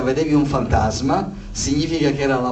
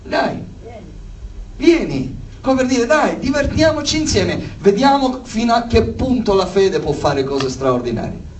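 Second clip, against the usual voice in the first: high-pitched, eager egging-on; acted enthusiasm, starting high and settling; playful invitation; 5 semitones higher than usual. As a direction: voice it like daring a friend to join the fun. Jesus voiced playfully inviting Peter.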